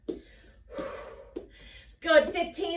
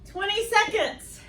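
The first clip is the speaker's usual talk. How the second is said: high energy call; 8 semitones higher, 3 dB louder than usual. Real, not acted. Coach calling interval time.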